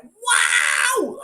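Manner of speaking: high excited wow; sugar-crazed kid exclaiming; imitating hyper kid. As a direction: burst out like a wired child. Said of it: "went from, like, normal to".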